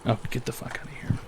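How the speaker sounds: unvoiced, very soft brush-off; whispered dismissal. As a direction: breathe it out under your breath. Dismissing the caller.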